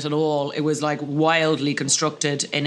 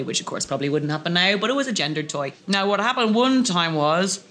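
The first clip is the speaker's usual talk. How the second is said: ordinary delivery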